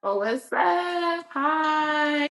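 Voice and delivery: very high, slow squealed hello; bubbly greeting. Real, not acted. Guest greeting the host, squealing with delight.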